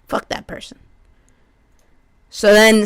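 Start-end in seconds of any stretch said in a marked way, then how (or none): 0.1-0.8 muttered aside. Real, not acted. Muttered aside after paltry.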